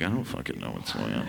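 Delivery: a confused student in math class; clueless dumb voice. Retold or imitated. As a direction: sound completely lost.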